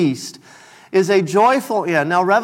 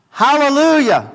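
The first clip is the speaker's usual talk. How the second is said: raised, higher Hallelujah as the crowd cries out; voicing the multitude; 5 semitones higher, 3 dB louder than usual. Preacher voicing heaven's crowd, raised and higher. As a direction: lift it like a shout of praise.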